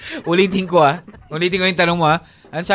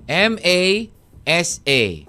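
slow letter by letter, M A S A; careful dictation of the letters; spelled out; much slower than usual. Shown as a demonstration. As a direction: spell each letter slowly, one at a time. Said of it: "Spell Massachusetts".

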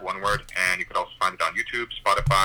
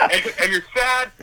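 high mocking rant with a burst of laughter; retold yelling rant; 14 semitones higher, 3 dB louder than usual. Yelling at the old driver, high mocking rant. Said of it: "I was, like, yelling".

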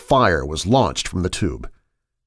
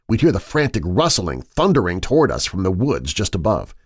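usual speaking voice

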